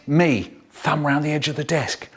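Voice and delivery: normal talking voice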